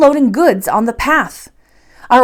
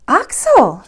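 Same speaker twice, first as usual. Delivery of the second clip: surprised exclamation; 5 semitones higher, 4 dB louder than usual. A narrator's reading. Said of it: "she exclaimed in surprise".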